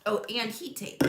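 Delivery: ordinary talk, nothing marked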